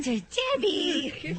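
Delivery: put-on high squeaky baby talk broken by laughter; laughing baby talk. Shown as a demonstration. Cohost laughing as she tries to baby talk the vet's name. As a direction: coo her name through a giggle. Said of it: "you don't even do a good baby talk".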